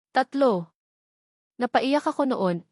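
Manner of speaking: ordinary delivery, nothing marked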